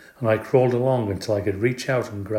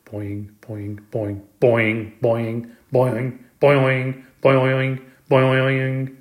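imitated boings; 3 dB louder, much slower than usual. A narrator's reading. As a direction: bounce each springy boing, growing louder. Imitates the frog bouncing noisily.

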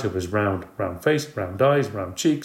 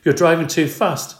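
scolding; 7 semitones higher, 5 dB louder than usual. A narrator's reading. Mandy scolding father.

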